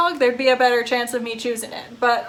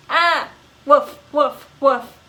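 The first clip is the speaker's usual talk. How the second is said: loud yelping woofs; startled barking; 2 semitones higher, 4 dB louder, slower than usual. Acted. Dog startled by the bell, loud barking. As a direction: bark it out like the bell made you jump.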